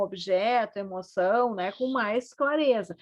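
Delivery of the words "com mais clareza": usual speaking voice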